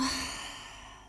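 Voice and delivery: long unvoiced breath out; big exhale sigh. Shown as a demonstration. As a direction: breathe out audibly, letting go. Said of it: "Big exhale".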